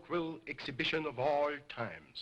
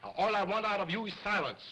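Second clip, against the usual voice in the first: higher, stern demand; 7 semitones higher, faster than usual. Acted. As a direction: order silence sternly. Scolding dummy.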